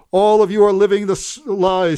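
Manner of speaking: high, loud screamed line; old woman screaming down from her window. Acted. Old Missus Green screaming from her window at everyone, loud and high. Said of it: "screamed old Missus Green".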